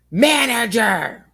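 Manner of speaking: loud, high, drawn-out mock yell; screamed demand. Homegirl's customer comes on the line demanding escalation, screaming. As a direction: slowly holler it like an irate caller.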